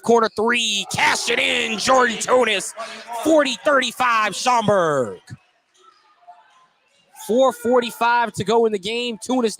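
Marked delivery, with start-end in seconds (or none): none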